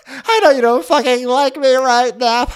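high, loud mock-tearful protest; hammed-up pleading. Rinna begging Garcelle for credit, hammed-up pleading. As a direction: protest loudly in a high, overwrought voice.

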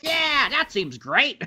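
high, slow, smug mock approval; a hammed-up, self-satisfied politician voice. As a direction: pretend to be pleased with himself. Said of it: "Be like".

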